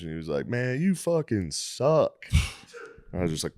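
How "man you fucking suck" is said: slow, drawn-out put-down, pitched up; imitated drawling heckle; cool heckler. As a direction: stretch it out as a lazy taunt. Retelling a pool player's heckle.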